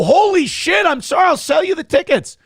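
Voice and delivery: hammed-up terror from a caught scammer; mock panicked scammer. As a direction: scammer begging after a threat, mock panic. Scared scammer voiced in story.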